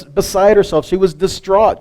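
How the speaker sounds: normal talking voice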